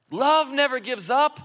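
loud, high proclamation; preaching shout. Real, not acted. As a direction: belt it out to the church. Preacher building to climax.

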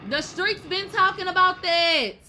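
high, loud, slow and drawn out; loud drawled gossip. Acted. Host spilling street rumor to viewers, loud and drawn out. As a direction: stretch it like juicy gossip.

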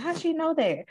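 high-pitched retold amazement; astonished question. Retold astonishment at massage therapist.

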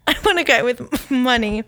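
coughing, choking mid-sentence; words squeezed out while choking; choking on a drink. Real, not acted. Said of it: "I'm choking because God doesn't want me".

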